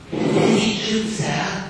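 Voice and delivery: put-on high, timid girl's voice; blushing little girl asking; shy schoolgirl. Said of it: "exclaimed number twenty, blushing".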